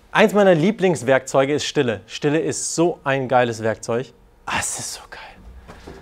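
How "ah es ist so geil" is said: slow whisper of delight; slow hushed relish. Real, not acted. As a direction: breathe it out slowly, savouring it. Savouring his favourite tool.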